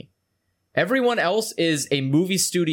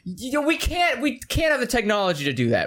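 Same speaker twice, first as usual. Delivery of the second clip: sarcastic studio excuse; 6 semitones higher than usual. Retold or imitated.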